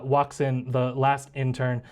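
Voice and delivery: ordinary talk, nothing marked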